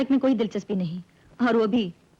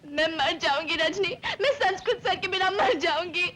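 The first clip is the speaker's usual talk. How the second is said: melodramatic tearful wail; crying; 4 semitones higher than usual. Acted. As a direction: sob as if life is over. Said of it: "इसमें रोने की क्या बात है".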